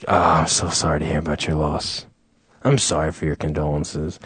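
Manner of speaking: low, soft mock-solemn voice; scripted condolence. Funeral guest to the bereaved, low rote sympathy. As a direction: recite sympathy low and hushed. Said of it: "you have your set script".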